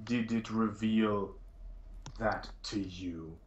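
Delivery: slow, deliberate suspicion, trailing to a hush; suspicious. Acted. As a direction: draw the question out with distrust.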